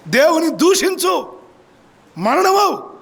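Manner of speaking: hammed-up wife hurling curse God and die; preacher voices Job's wife's bitter taunt; mock scornful wife.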